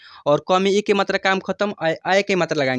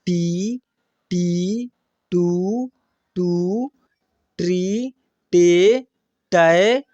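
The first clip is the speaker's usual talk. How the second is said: each syllable drawn out and intoned singly; syllable drill recitation; much slower than usual. Shown as a demonstration. Teacher reads out barakhadi for practice, drawn-out recitation. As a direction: recite the alphabet slowly, stretching every vowel. Recites the letter series for learners.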